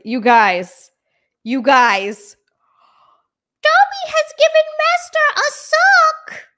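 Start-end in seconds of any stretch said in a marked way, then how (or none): 3.6-6.5 much higher, louder squeaky elf voice; Dobby voice. Pretend. Quoting Dobby to chat about her Lego Dobby, squeaky elf voice. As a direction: squeak it like a proud house-elf.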